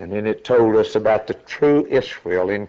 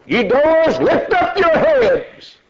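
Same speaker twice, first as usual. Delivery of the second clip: high, slow ringing command; herald's command; 3 dB louder, slower than usual. Acted. Herald commanding the doors to rise, slow and high. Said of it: "a voice comes that said".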